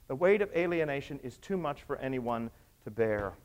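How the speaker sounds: ordinary talk, nothing marked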